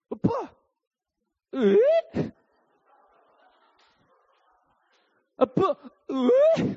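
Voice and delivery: put-on high, crying toddler demand; whiny toddler. Toddler wakes and cries for dad, high whining demand. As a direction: whine for dad like a fussy baby. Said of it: "Jed always goes".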